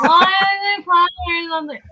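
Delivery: put-on high, slow whining kid; whiny kid voice. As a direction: whine like a pestering child. Kids asking five minutes later.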